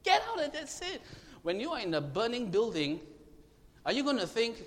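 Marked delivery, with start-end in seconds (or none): 0.0-1.0 sharp, high scolding cry, much louder; scolding shout. Shown as a demonstration. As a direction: scold them out of their sin. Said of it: "you need to scold them sometimes and say".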